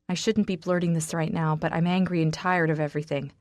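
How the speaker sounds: usual speaking voice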